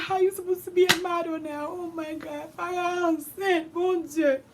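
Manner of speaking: very high, whiny weeping voice; crying hard, imitated. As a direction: wail it as a despairing mother. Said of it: "She was crying hard".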